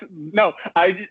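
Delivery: ordinary talk, nothing marked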